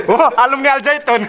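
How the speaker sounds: high-pitched teasing laugh through the words; laughing jab. Real, not acted. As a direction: laugh it out, mocking. (tertawa mengejek dengan nada tinggi)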